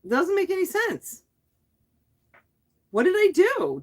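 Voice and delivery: what on earth did I do; high, incredulous cry of frustration; exasperated disbelief. Real, not acted. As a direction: voice jumps way up in bafflement. Baffled by the glitch.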